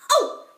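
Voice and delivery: high, loud operatic "Oh!"; hammed-up high yelp; mock choir warm-up cry. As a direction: belt a silly high note like a conductor. Teacher cues choir with a cry.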